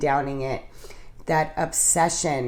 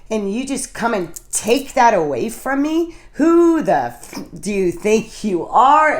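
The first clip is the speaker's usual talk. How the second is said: indignant list building higher and more emphatic; retold pissed-off rant; 3 semitones higher, 3 dB louder than usual. Drinker raging inwardly at losing alcohol, retold angry outburst. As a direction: build from aggrieved to outraged, stretching the key words. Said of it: "wow, I was pissed".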